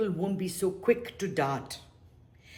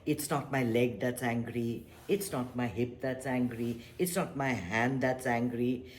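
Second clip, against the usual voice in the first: ordinary talk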